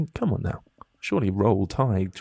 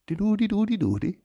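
much higher singsong dee-doo babble in a mock Dutch voice; Dutch accent warm-up noise; 12 semitones higher than usual. Actor recalling his audition warm-up, high mock Dutch babble. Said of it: "I was like".

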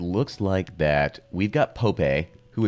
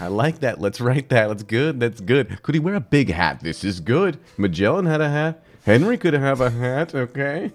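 mock excitement building to higher, louder pitch; hammed-up pitch-meeting enthusiasm; excited brainstorming; 5 semitones higher, 3 dB louder than usual. Two German guys inventing a nickname, mock-excited.

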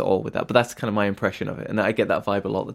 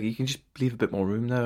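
ordinary talk

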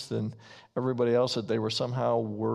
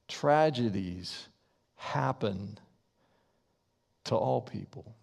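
slow dictation. Shown as a demonstration. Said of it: "you can write this down".

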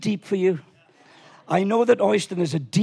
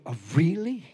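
ordinary delivery

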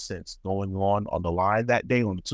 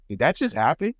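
higher-pitched incredulous question; retold stunned reaction; disbelief; 5 semitones higher than usual. To the boss after being snapped at, in disbelief.

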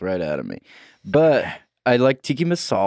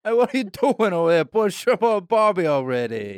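mock Australian accent; 7 semitones higher than usual. Continuing Australian accent bit.

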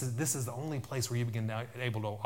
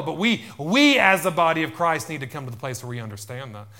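loud, high, emphatic pulpit shout; full-force preaching; 5 semitones higher, 9 dB louder than usual. Real, not acted. Preacher denouncing a lie from hell, shouted preaching. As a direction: thunder the rebuke at the congregation.

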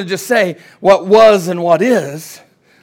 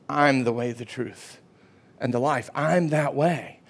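low, quiet, measured declaration; Jesus; 6 semitones lower, 7 dB quieter than usual. Acted. Preacher quotes Jesus on the way, low and quiet. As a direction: say it low and softly, slowing down. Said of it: "what's he say?".

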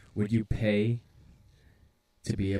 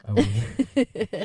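much higher, very slow squeak; high cartoon dog voice; 12 semitones higher, 3 dB louder, much slower than usual. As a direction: drag it out in a tiny voice. Repeating in the dog voice.

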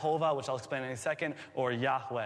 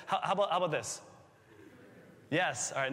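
ordinary delivery

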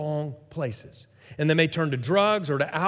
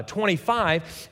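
ordinary talk, nothing marked

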